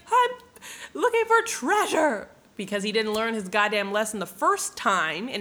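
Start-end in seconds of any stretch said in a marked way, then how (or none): 0.1-2.3 very high, loud, drawn-out cartoon voice; hammed-up desperate answer. Beaten Santiago answering the thieves, hammed-up desperation. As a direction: blurt it high and stretched, mock pleading.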